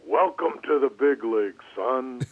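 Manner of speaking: loud, mildly booming welcome. Retold or imitated. Star player greets the rookie in the clubhouse, booming. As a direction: imitate a big man's booming greeting. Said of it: "with his booming voice".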